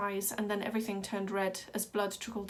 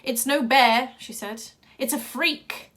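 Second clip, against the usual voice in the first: louder, separate voice, contemptuous; ringmaster's verdict; 4 semitones higher, 9 dB louder, slower than usual. A narrator's reading. Ringmaster telling the crowd the boy is a freak, with contempt. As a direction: sneer it out to the crowd.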